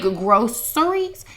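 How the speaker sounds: "groceries" drawn out as GROSS-ur-eez; "groceries" stretched, with S for the C. Shown as a demonstration. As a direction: say "groceries" slowly, leaning on the "s". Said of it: "Reason why it's spelled G R O C".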